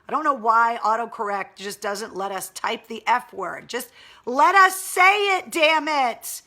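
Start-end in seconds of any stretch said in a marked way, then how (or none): 3.7-6.4 much higher, louder frustrated cry; exasperated outburst. Real, not acted. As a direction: vent at autocorrect in exasperation. Frustrated rant at autocorrect.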